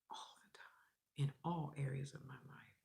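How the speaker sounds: soft whispered tail. Real, not acted. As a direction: let it fall to a whisper. Trailing whisper.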